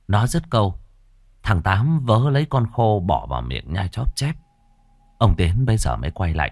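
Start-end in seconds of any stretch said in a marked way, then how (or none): none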